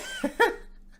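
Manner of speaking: squeaky breathless laugh; laughing in disbelief at the headline; high wheezy laugh. Real, not acted. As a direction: let out a high giggle. Laughing at Elon's poll.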